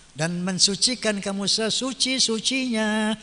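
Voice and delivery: ordinary talk, nothing marked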